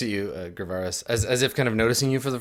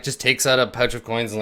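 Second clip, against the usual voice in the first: ordinary delivery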